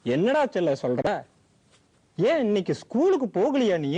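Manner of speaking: put-on old grandfather coaxing a crying granddaughter; kind old grandfather. Grandfather figure voiced.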